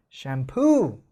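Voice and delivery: 샴푸 with the weight on 푸; right stress. Shown as a demonstration. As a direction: land the stress on the second syllable of 샴푸. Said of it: "라고 해줘야 된다라는 거예요".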